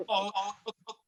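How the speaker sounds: real laughter breaking up the words; oh oh oh spoken mid-laugh; laughing through words. Real, not acted. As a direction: giggle out each oh. Laughing while retelling story.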